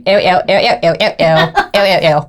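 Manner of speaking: rapid run of squeamish little ews; squeamish ewws. Retold or imitated. Wife retelling her trek through the bush, squeamish. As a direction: act out being grossed out, ew after ew. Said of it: "I was like".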